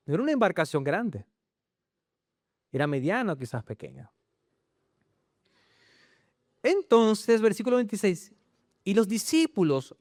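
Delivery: ordinary talk, nothing marked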